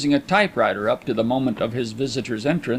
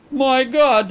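high, louder, bitter exclamation; 12 semitones higher, 5 dB louder than usual. A narrator's reading. Visitor interrupts memories of youth, bitter. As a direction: cut in with a bitter outburst. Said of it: "exclaimed Carlyle bitterly".

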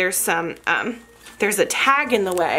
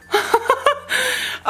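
high, squeaky giggle; delighted laugh. Real, not acted. Vlogger spotting a detail on the shoe, delighted giggle.

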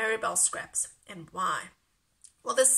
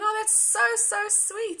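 much higher delighted gush; high, delighted inner thought; touched gush; 10 semitones higher, 6 dB louder than usual. Retold or imitated. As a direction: squeal it out, charmed. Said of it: "And I'm thinking".

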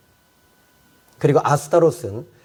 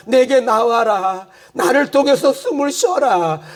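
God's call, building higher and higher; God pleading; 10 semitones higher than usual. Acted. Lord urging the weary soul, building plea. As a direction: plead as the Lord, climbing with each line.

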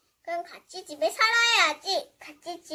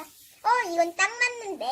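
ordinary delivery

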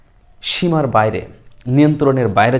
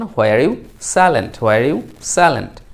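slow model sentence; much slower than usual. Shown as a demonstration. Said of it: "তাহলে আপনি বললেন যে".

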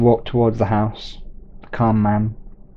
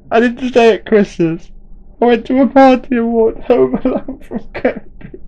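tearful confession; 13 semitones higher, 6 dB louder than usual. Acted. Simon confessing in tears.

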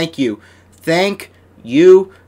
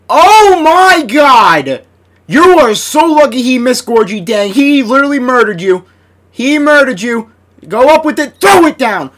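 much higher and louder, stunned; shocked shout; 8 semitones higher, 7 dB louder than usual. Real, not acted. Gamer to his player after a big dunk, a stunned loud shout. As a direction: blurt out the disbelief.

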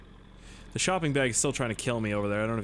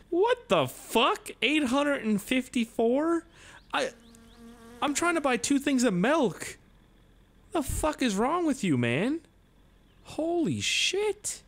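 high-pitched, drawn-out disbelief; incredulous outrage; 13 semitones higher, slower than usual. Real, not acted. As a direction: protest the price in stunned, high voice. Outraged at a price in store.

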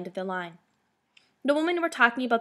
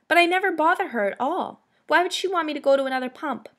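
higher, louder protest, voiced by a narrator; puzzled protest; 7 semitones higher, 5 dB louder than usual. A narrator's reading. Girl protests to the woman about her bully, puzzled and hurt. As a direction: protest innocence, pitch lifted.

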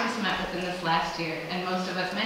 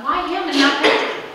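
mock protest; 7 semitones higher, 7 dB louder than usual.

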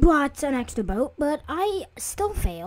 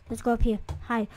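normal talking voice

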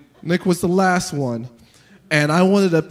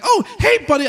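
much higher forced cheer; high fake-friendly greeting; 13 semitones higher, 4 dB louder than usual. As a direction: greet him awkwardly bright. Awkward greeting to a kid.

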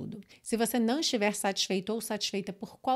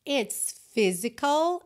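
slow demo line; 4 semitones higher, 4 dB louder, much slower than usual. Shown as a demonstration. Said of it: "atenção à pronúncia".

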